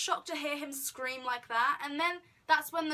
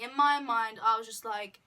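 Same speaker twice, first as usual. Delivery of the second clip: ordinary delivery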